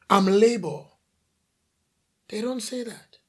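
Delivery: normal talking voice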